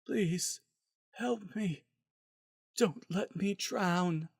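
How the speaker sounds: high, soft, desperate begging; pleading patient voice. A narrator's reading. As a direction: plead faintly as if drowning. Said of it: "ignoring their cries and begging".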